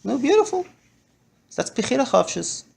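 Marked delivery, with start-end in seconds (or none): 0.0-0.7 much higher, pleased; father praises daughter, delighted. Retold or imitated. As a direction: reward the answer warmly. Praising the child.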